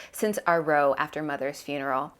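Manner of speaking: ordinary delivery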